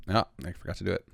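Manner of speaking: ordinary talk, nothing marked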